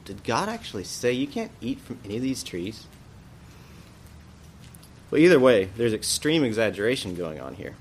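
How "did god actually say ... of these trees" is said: softer, mocking disbelief; scornful snake mocking the command; ridiculing. Shown as a demonstration. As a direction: sneer at the idea as absurd. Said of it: "a tone of ridicule".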